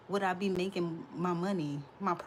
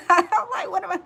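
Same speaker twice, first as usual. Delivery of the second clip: high, loud words squeezed out through laughter; cracking up at herself; laughing so hard at her own idea the words come out high; 11 semitones higher, 14 dB louder than usual. Real, not acted.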